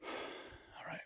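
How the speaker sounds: hushed whisper so the birds won't hear. Real, not acted. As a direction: breathe the words without voice. Stalking skittish birds close by.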